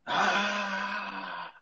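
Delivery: restrained imitation of a captain's battle yell; mock war cry. Captain rallying troops before battle, mock war cry. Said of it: "o capitão dá aquele grito".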